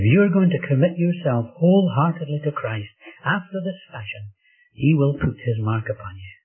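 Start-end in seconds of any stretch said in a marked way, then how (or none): none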